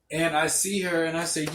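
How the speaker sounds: ordinary talk, nothing marked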